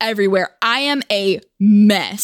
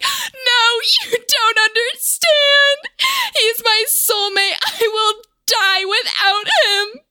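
mock hysterical crying; 13 semitones higher, slower than usual. To the stranger, pretending to sob in a high wail. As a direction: wail it like a lovesick wreck. Fake sobbing plea over boyfriend.

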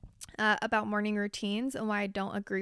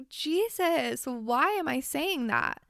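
exasperated self-scolding; 4 semitones higher, 3 dB louder, slower than usual. Retold or imitated. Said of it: "I'm like".

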